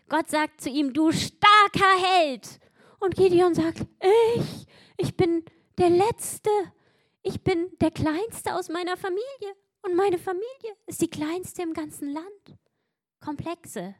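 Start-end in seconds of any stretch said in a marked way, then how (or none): 0.9-2.6 slow, loud, much higher proclamation; God calling Gideon a mighty hero; booming voice of God. Acted. 4.4-12.6 higher, hesitant self-pity; a hint of a small, unsure man; lightly voiced timid Gideon. Gideon's timid self-doubt quoted.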